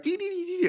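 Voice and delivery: singsong imitated phone-side dithering; high, soft giddy "dee-dee-dee". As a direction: trill little high dees, softly. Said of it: "I'm on my side of the phone, like".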